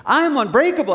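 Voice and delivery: high, loud, convinced affirmation; out loud, meaning it. Acted. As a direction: belt it out like you mean it. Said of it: "say it out loud like you mean it".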